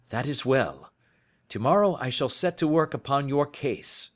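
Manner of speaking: detective pressing for evidence; Holmes urgent. Acted. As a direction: urge him on, impatient. Holmes's dialogue voice.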